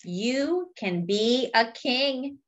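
ordinary delivery

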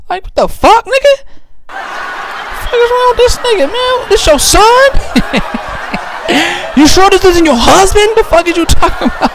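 very high, laughing squeal of disbelief; high-pitched comic shock at the kid's looks. Retold or imitated. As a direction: shriek it through laughter.

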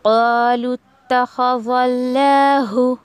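क़ालू तख़ज़ल्लाहु recited slowly, the लाम merged into ता; slow careful recitation of क़ालू तख़ज़ल्लाहु; tajweed recitation. Shown as a demonstration. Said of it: "इस तरीके से".

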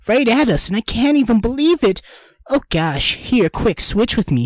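flustered friend, mild excitement; babbled. A narrator's reading. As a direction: rush it like a flustered girl. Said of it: "she babbled".

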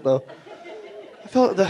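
normal talking voice

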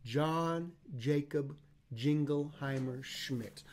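John Jacob Jingleheimer Schmidt said very slowly; over enunciating. Shown as a demonstration.

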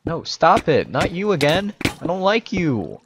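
loud, high-pitched complaint; indignant protest. Real, not acted. Player sees the same enemy again, loud indignant protest.

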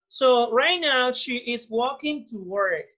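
slow, careful dictation pace; slow model sentence. Shown as a demonstration. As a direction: spell it out slowly for the class. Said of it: "teacher models sentence slowly". (lectura lenta y clara)

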